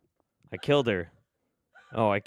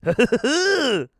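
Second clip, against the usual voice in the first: high squealing wheeze laugh; wheezing laugh; 12 semitones higher, 5 dB louder than usual. Real, not acted. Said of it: "that's how I wheeze".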